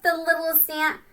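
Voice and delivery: plain reading voice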